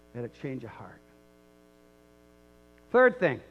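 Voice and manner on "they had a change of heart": hushed repeat. Shown as a demonstration. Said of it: "and they, they had a change of heart".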